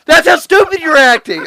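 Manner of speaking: ordinary talk, nothing marked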